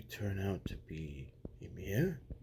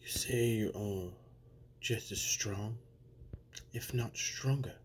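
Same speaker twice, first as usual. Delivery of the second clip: ordinary delivery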